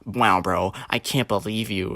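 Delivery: lightly imitating an offended woman; a hint of hurt, womanly disbelief, mockingly put on; mock female. Gamer friend acting offended at being called a cope, mock-female. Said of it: "he became, like, a female".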